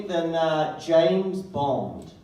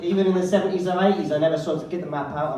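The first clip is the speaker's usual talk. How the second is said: ordinary delivery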